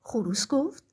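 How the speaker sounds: ordinary delivery, nothing marked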